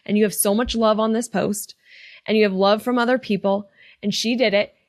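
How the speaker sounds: ordinary talk, nothing marked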